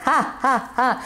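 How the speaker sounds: short mock evil cackle; machiavellian laugh. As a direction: cackle like a scheming villain. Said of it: "Excusez-moi pour ce rire, euh, machiavélique".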